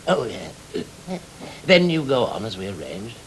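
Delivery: ordinary talk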